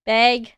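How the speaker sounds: "Bag" pronounced close to beg; extra E in "Bag". Shown as a demonstration. Streamer demonstrating her accent slip, E-like "Bag". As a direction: put an E sound into "Bag". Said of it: "I added extra E for some reason".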